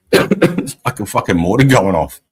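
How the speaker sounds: ordinary talk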